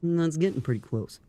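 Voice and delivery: echoing him in a put-on man's voice; Dartigan. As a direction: parrot him, imitating his manly tone. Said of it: "do your Dartigan impression".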